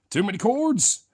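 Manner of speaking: high-pitched comic whine about cords; silly mock complaint.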